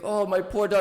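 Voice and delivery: high mock lament. Johnny mourning his dog, mock grieving and high. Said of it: "And he's like".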